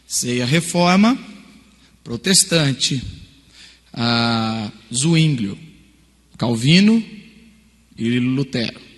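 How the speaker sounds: slow deliberate search terms, word by word; slow typing. Retold or imitated. Student slowly typing keywords into Google. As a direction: spell the keywords out as if typing them.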